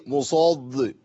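dark, heavy "musoḍḍ" for مصد; d turned heavy. Retold or imitated. Teacher imitating a student repeating after him, heavy mispronounced d. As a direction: say مصد with the d thickened into ḍād. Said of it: "حد دلوقتي بيردد ورايا يقول لك إيه".

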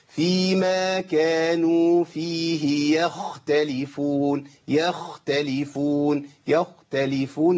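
يختلفون chanted slowly with a clear خ; clear kha. Shown as a demonstration. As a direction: stress the خ in يختلفون. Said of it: "اسمعوا كده الخاء". (نطق الخاء واضحة في يختلفون)

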